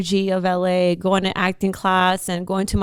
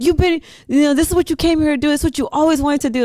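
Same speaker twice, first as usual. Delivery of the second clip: firm friend; 7 semitones higher, 3 dB louder, faster than usual. Retold or imitated. Friend snapping him out of it.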